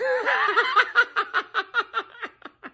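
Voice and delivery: high cackling laugh; gleeful laugh. Real, not acted. Fan cackling after taunting the Cowboys, gleeful laugh. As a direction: let the laugh burst out. Gloating over rival's loss.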